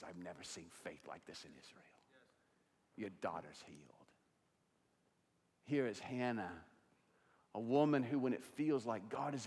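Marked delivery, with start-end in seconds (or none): 0.0-4.0 hushed Jesus. Acted. Jesus voiced softly.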